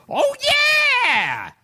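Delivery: long drawn-out "oh, yeah"; Freddie Rogers catchphrase. Retold or imitated. Guest recalling an old TV comic, drawn-out catchphrase. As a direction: stretch out the comedian's catchphrase. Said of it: "his catchphrase was".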